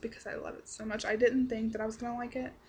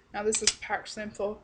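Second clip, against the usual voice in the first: ordinary talk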